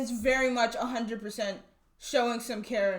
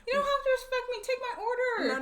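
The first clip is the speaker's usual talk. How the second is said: mock impatient customer; 12 semitones higher than usual. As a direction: whine it like a pushy diner.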